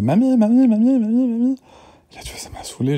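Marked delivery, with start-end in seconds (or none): 0.0-1.6 put-on high, loud whiny child calling mamie over and over; imitating the little daughter. Parent imitating his daughter interrupting him, high whiny child voice. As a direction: mimic a pestering little girl nagging for grandma. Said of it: "elle arrive, elle fait".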